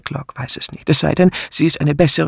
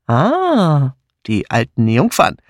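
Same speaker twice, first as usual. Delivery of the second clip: drawn-out triumphant "Ah"; mildly triumphant, savoring the moment; slower than usual. A narrator's reading. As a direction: crow it with satisfaction. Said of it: "stieß Sir Henry triumphierend hervor".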